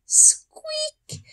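tiny, very high, whispered squeak; a mouse squeak breathed to himself; small mouse squeaking quietly to himself. Acted. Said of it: "he whispered to himself".

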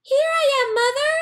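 very high, loud happy calls; joyful calling chick. A narrator's reading. Baby bird runs to the big thing calling Mother, high and loud. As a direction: run up calling her. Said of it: "he said to the big thing".

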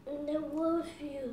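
soft pleading. Real, not acted. Child asking mother not to go, soft plea. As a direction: plead quietly, barely pushing the voice.

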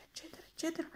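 the list dissolving into nothing; fading away. A narrator's reading. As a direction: let it vanish into the distance. Sequence trailing off endlessly.

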